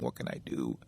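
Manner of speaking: low, soft, despairing murmur; heartbroken, half to himself; sad crying thought. A narrator's reading. Rejected beaver wandering the woods, sad and crying. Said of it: "feeling sad and crying and thinking".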